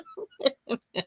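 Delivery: quick high chuckle; host laughing after mocking listeners; short laugh. Real, not acted. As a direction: laugh at your own line. Laughing at own joke.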